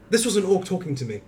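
ordinary talk

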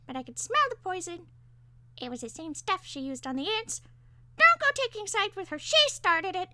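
shrill, self-justifying parakeet; arguing parakeet. A narrator's reading. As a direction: argue indignantly in a little bird voice. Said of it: "the parakeet argued on his own behalf".

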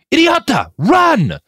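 the fixer screaming a warning at the fleeing soldier; bellowing at the top of lungs. Acted. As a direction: scream it across the field to save him. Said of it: "the fixer bellowed at the top of his lungs".

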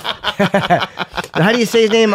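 slow, laughing question. Real, not acted. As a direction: laugh out the question while stuck. Laughing, unsure of the name.

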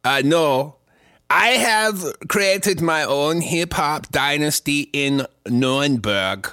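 lightly put-on German rapper accent, slow; hammed-up Nuremberg hip hop star, drawn out. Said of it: "I just say it with a German accent".

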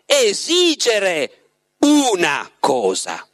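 "una" underlined, drawn out very slowly; stress on una. A narrator's reading. Voland stressing to Margherita she gets one wish, "una" slow. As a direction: lean hard on "una" in "Esigere una cosa". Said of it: "aveva sottolineato abilmente e chiaramente una cosa".

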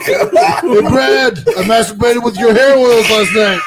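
high, loud stranger calling out across the street, laughing; hammed-up shout at Brad from a stranger; mock street yell. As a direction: yell it at him like a weird fan on the sidewalk. Imagined stranger yelling on street.

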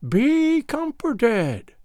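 high, very drawn-out mock comfort; piping, mocking old crone; hateful mockery. A narrator's reading. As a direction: stretch it high in false comfort. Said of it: "hateful tone of mockery".

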